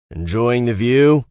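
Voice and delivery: playful, slow shout to the woman behind him; calling out. Acted. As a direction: call it back over your shoulder, drawn out. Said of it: "I called out".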